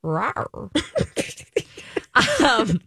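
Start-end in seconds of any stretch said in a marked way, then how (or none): 0.0-0.7 soft, sultry rising rawr; sexy rawr. Shown as a demonstration. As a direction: purr a sexy little growl. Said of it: "like a sexy rawr".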